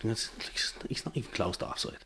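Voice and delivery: hushed, unvoiced mutter of complaint; whispered aside. Linesman whispering that it's not offside. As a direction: grumble it under your breath.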